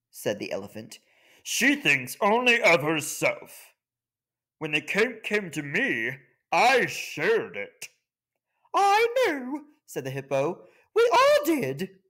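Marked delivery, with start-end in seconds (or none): 1.4-7.9 smug elephant. Acted. Elephant scolds the ant. 8.7-9.6 very high, slow sneering voice; a squeaky high sniff of contempt; hippo's scornful thought. Acted. The hippo judges the elephant, high and slow. 10.9-12.0 very high, slow and self-satisfied; high smug hippo. Acted. The hippo agrees with the elephant, very high and smug.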